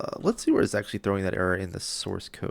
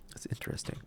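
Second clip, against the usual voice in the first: whisper; 10 dB quieter, faster than usual. Real, not acted. Quiet aside to self.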